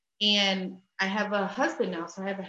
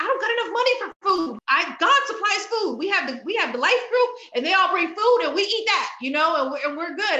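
high, loud, fast protest; defensive insistence; 8 semitones higher, 5 dB louder than usual. Retold or imitated. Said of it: "I was like".

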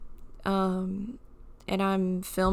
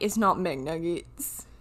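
deadpan correction; 3 semitones lower, slower than usual. Retold or imitated. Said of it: "I'm like".

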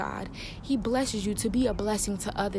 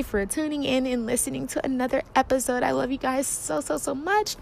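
higher, fond farewell; affectionate sign-off; 5 semitones higher than usual. Real, not acted. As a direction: gush over your listeners.

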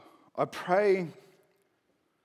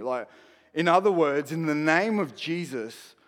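ordinary talk, nothing marked

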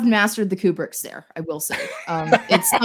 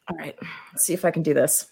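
1 whispered to herself; 3 semitones lower, 7 dB quieter, faster than usual. Real, not acted. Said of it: "gathering herself before performing".

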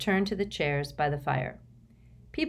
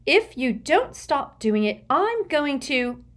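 threatening; 9 semitones higher, 5 dB louder than usual. A narrator's reading. Hermione threatening.

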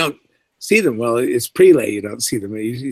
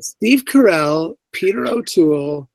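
slow bewildered list; slower than usual. Retold or imitated. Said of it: "I think".

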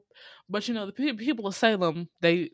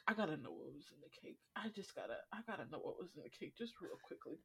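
much softer, fast, hushed rush; hushed urgent aside; 17 dB quieter, faster than usual. Real, not acted. Podcaster desperate to know the cake recipe, hushed and quick.

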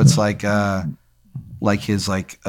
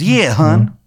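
put-on high, terrible Maryland accent; badly faked Maryland accent, pitched way up; Shithead Steve's Maryland accent; 7 semitones higher, 5 dB louder than usual. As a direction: proclaim it in a hammed-up Maryland twang. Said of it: "he's got, like, a terrible Maryland accent".

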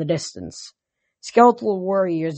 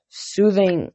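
ordinary delivery

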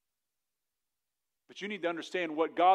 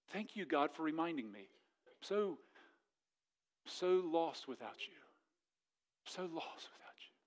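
soft prayer; 13 dB quieter than usual. Real, not acted. Quiet prayerful aside.

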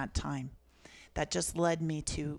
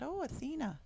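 a high, wondering "Oh, Athena"; light surprise at the name on the page; surprised; 10 semitones higher than usual. Retold or imitated. Surprised by the name she wrote.

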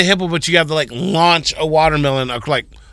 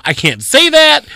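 exasperated, higher; 11 semitones higher, 4 dB louder, slower than usual. Real, not acted. Venting about being called insensitive.

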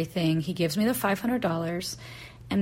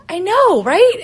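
much higher and louder, retold exclamation; emphatic agreement; 12 semitones higher, 11 dB louder, slower than usual. Agreeing with rake guy after the scare, emphatic. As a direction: agree wholeheartedly, acting out the moment.

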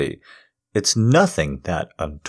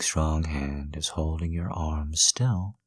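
usual speaking voice

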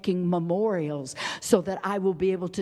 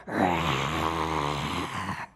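mock growl. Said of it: "that nasty little voice".

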